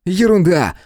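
high, excited dismissal; buoyant, high-voiced exclamation; breezy reassurance. A narrator's reading. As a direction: wave the worry away eagerly. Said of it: "успокоил его Том".

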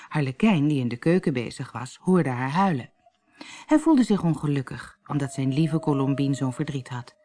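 plain reading voice

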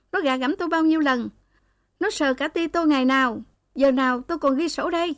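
high, fast wailing lament; keen it out like a mourner; loud lament. A narrator's reading. Girl wails at the director's door, high and fast. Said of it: "hờ thật to".

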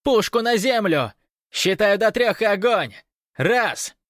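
very high, loud command; alarmed guard shouting orders; yelled ultimatum. A narrator's reading. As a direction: yell a final warning and start counting. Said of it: "заорал динамик".

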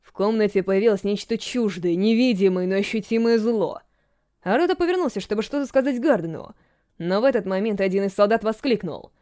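usual speaking voice

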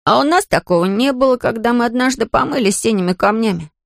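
put-on high childish complaint; hurt, aggrieved boy. A narrator's reading. Boy never got powers from stones, sounding offended. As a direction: protest like a miffed young boy. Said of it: "обиженно сказал Келвин".

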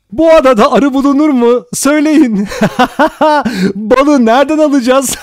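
very high, ear-piercing shout breaking into mad laughter; Vera's shrill hysterical shouting. A narrator's reading. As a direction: scream it shrilly, cackling like someone gone insane. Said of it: "Tiz, kulak tırmalayan bir sesle bağırıyordu". (tiz, kulak tırmalayan sesle kahkahalı bağırış)

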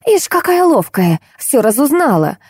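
high-pitched indignant sneer; squealed jeer. A narrator's reading. Woman accuses the snooping heroine, squealing. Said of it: "взвизгнула собеседница".

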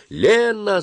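very high cry of a name; shouted call. A narrator's reading. Dentist summoning her helper back, crying out. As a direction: call out, pitched right up. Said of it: "закричала она".

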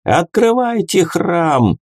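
high, drawn-out demand; stretched, insistent cry; shout from crowd. A narrator's reading. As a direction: call out slowly from the mob. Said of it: "выкрикнул кто-то из толпы".

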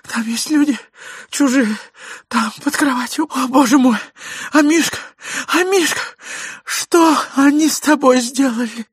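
high, wailing distress; distraught lady. A narrator's reading. Lady revealing strangers under bed, high wailing. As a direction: lament in a high, frightened lady's voice. Lady wails over hidden intruders.

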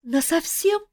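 high shocked chick cry; horrified. A narrator's reading. Chick Mafei learns he lost his power, high horrified. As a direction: gasp it out in horror. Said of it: "Ужаснулся Мафей".

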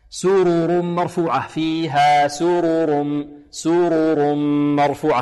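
slow chant with both r's of سرر sounded; measured model recitation of سرر مرفوعة; full distinct vowels. Shown as a demonstration. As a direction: draw out سرر مرفوعة, humming into the m. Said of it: "هكذا".

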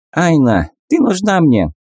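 high squeaky monkey voice; squeaky macaque. Acted. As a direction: pipe it like a shrill little monkey. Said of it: "заговорила писклявым голосом макака".

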